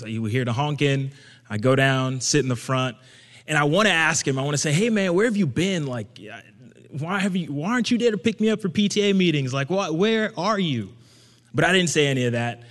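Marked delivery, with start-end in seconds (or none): 4.7-10.9 raised, higher complaint at dad; rehearsed confrontation he never voices; imagined outburst. Pretend. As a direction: let the hurt spill out loud. Imagined confrontation with cab-driving dad.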